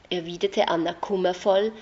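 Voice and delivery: ordinary delivery, nothing marked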